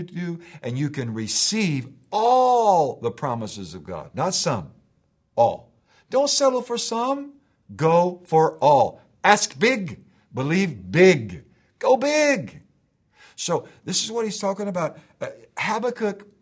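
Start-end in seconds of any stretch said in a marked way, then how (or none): 4.1-12.6 loud, rising preacher's call; full-throated sermon climax; preaching at full force. Acted. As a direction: build it up and shout it to the congregation.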